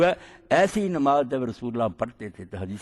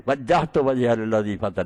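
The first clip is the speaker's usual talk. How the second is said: slow, chanted recitation of the verse; آیت کی دھیمی تلاوت; Quranic recitation; 2 semitones lower, slower than usual. Acted. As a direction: intone it slowly like tilawat. Quranic verse recited mid-sermon.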